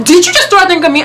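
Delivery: retold angry demand, high and fast; in-his-face confrontation. Storyteller replays squaring up to the drink thrower, angry. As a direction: fire the question right up in his face. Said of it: "I'm up in his face".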